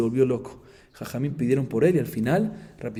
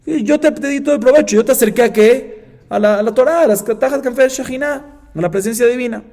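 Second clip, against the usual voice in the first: high, loud offended protest; indignant retort; 9 semitones higher, 9 dB louder than usual. Retold or imitated. Rabbi answering his ungrateful student, indignant. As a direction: throw the question back, raised voice.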